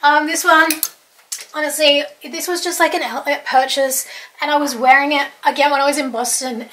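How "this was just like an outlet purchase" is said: ordinary delivery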